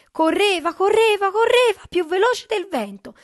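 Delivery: high, loud, building excitement; urgent narration. A narrator's reading. Narrator tells children of the chase, excited and urgent.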